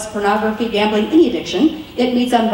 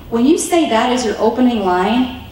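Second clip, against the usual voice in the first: normal talking voice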